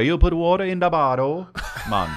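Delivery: put-on slow, high Jamaican accent; hammed-up Jamaican accent. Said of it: "like your Jamaican accent".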